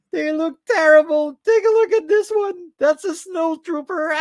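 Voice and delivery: high, loud squeal on the edge of laughter; loud, high-pitched hilarity; cracking up. Real, not acted. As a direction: squeal it through helpless amusement. Mocking figures, helpless with laughter.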